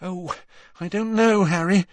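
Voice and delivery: waver, unsure whether to go; hesitant Hermione. Acted.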